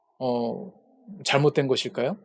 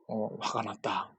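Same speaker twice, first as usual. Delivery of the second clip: ordinary delivery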